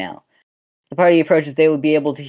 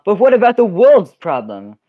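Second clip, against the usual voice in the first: higher, louder mock yell of worry; hammed-up worried councilman; 5 semitones higher, 5 dB louder than usual. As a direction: call out over the others. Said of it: "you hear one yell".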